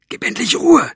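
forceful high hiss into her ear. A narrator's reading. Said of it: "zischte Elinor ihr ins Ohr". (energisch ins Ohr gezischt)

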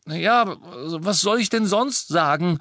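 high, loud protest; defensive shout. Acted. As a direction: call out in self-defence. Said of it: "rief Ron".

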